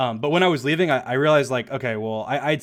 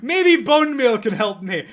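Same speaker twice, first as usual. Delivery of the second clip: high, loud, drawn-out mock hopefulness; slow it down, poking fun at yourself; self-mocking; 11 semitones higher, 8 dB louder, slower than usual. Player mocking own bone meal idea, high and slow.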